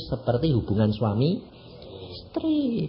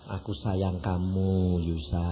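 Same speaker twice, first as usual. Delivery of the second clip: soft, low and slow love words; tender whisper to God; 8 semitones lower, 7 dB quieter, slower than usual. Retold or imitated. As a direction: murmur it like a lover. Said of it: "tak pikir, "Eh, Tuhan". (lembut dan pelan penuh kasih)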